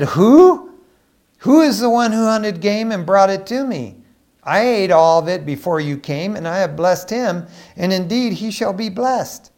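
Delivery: high, loud outburst, easing slightly; acted alarm. Isaac learns another son got the blessing, acted alarm. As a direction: act out a father's shocked demand. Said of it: "You talk about freaking out".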